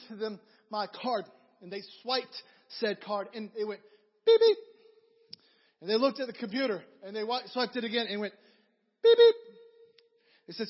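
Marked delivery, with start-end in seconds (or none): 4.3-4.6 high, loud mock beeping; card reader beep. 9.0-9.3 high, loud mock beeping; card reader beep.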